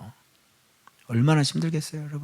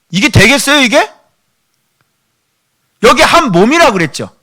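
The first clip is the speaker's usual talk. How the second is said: higher and louder with each question; rising preaching shout; 9 semitones higher, 7 dB louder than usual. Real, not acted. Preacher rebukes the church over a family's tears, shouting. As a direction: hammer the congregation, climbing to a cry.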